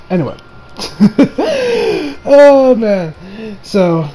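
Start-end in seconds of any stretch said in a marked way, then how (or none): 0.4-2.3 loud, high laugh breaking out; high burst of laughter. Real, not acted. Vlogger laughing at the anime leader's outburst, loud laugh. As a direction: crack up at the scene.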